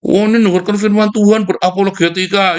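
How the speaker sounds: high, smug parody of a zealot; mock pious boast; mocking quote. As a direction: lampoon the pious talker. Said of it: "Kan sudah dibilang".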